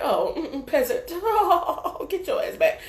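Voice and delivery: high, drawn-out mock snobbery with a laugh; haughty disregard. Snobs waving off a lowly peasant, mock haughty. Acts out snobs dismissing the viewer.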